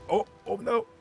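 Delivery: normal talking voice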